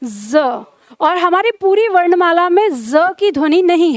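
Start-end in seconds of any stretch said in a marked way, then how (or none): 0.0-0.4 "ज" said as a buzzing z, lower and softer; Urdu z sound. Shown as a demonstration. Teacher answering her own question with the needed sound, soft z. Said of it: "किस ध्वनि की जरूरत थी?".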